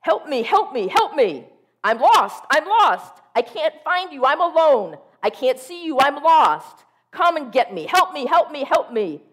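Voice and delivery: high, loud panicked pleading; frantic lost dog. Pretend. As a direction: voice the dog's hysterical barking as words. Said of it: "barking hysterically".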